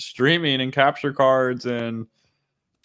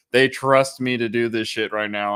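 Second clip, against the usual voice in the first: usual speaking voice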